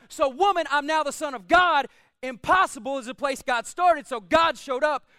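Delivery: full-force preaching. Acted. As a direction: drive each declaration home to the congregation.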